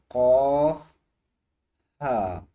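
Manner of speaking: क़ाफ़ called out loud. Shown as a demonstration. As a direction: belt out क़ाफ़. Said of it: "तो ये दो हरूफ हैं". (ज़ोर से क़ाफ़)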